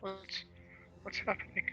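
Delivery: tiny, high, very soft question; scared. Acted. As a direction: ask in a faint frightened voice. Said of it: "is scared".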